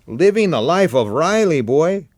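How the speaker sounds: lightly voiced breezy buddy tossing it off; Chico's voice. A narrator's reading. Chico teasing Tom.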